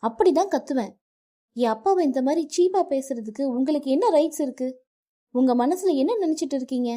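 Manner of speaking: furious wife shouting down her husband; defiant shouting. Acted. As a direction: scream back that you will shout. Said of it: "Varun கூறுவதை காதில் வாங்காமல்".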